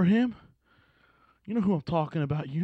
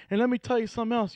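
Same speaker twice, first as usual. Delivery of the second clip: normal talking voice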